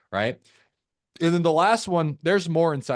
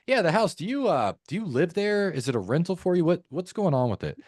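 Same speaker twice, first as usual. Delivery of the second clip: higher-pitched, hesitant, genuinely interested questioning; curious tone; 6 semitones higher than usual. Shown as a demonstration. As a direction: ask as if you truly wonder. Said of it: "One is curious".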